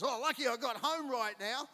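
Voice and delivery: son's quote. Retold or imitated. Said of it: "he comes and he goes".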